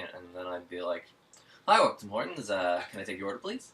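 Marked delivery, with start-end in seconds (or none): 1.7-3.7 bright upbeat host greeting, pitch jumping up on please; game show host voice. Retold or imitated. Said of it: "You sound like a game show host".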